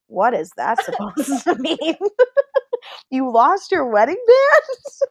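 incredulous question climbing high through laughter; laughing disbelief. Retold or imitated. Friends stunned by the lost wedding ring, laughing disbelief. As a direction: ask it aghast, cracking up.

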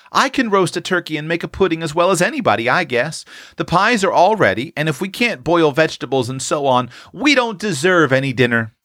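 put-on high, eager girl out to prove herself; eager self-confidence. A narrator's reading. Tilly claiming she can cook the dinner, eagerly confident. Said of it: "cried Tilly, burning to distinguish herself".

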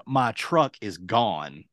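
slow, deliberate confirmation; retold emphatic repeat. Stranded man confirming to shocked friend, slow and deliberate. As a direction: spell out the bad news slowly.

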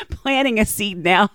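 ordinary delivery

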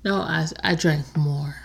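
tipsy hushed whisper. Real, not acted. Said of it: "I'm a little tipsy".

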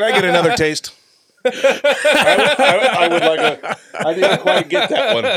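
high giggly voice, laughing through words. Real, not acted. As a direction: laugh while you ask. Laughing while asking.

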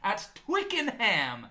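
mildly hammed victory report; excited hype. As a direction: brag like a proud fan. Hyped telling of the victory.

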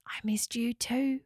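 soft breathy whisper back; a hushed, unvoiced reply. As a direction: whisper it tenderly. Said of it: "whispered back Locker".

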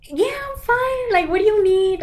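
mimicking friend shrugging it off; breezy I'm fine. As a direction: brush off the concern lightly. Imitating friend acting fine.

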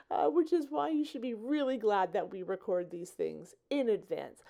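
ordinary talk, nothing marked

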